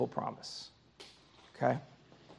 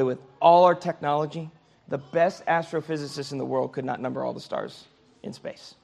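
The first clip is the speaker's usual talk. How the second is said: ordinary talk, nothing marked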